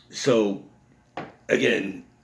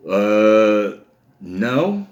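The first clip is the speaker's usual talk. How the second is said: loud drawn-out "uh", then questioning no; mock-innocent hesitant denial; feigned ignorance; 7 dB louder than usual. Kid answers mom about the boy, exaggerated hesitant denial.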